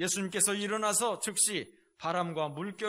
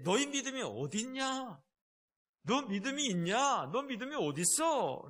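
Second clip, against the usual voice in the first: scolding rebuke; 5 semitones higher than usual. Acted. Jesus rebukes, Luke version.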